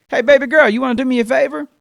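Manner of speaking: hollering a sweet request across the house; mock yell from the kitchen. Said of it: "she yells back into the bedroom".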